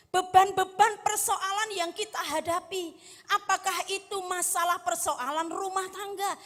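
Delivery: high, forceful sermon delivery; impassioned preaching. Real, not acted. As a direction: press each burden on the congregation. Preaches with raised voice.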